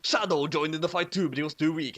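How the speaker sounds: ordinary delivery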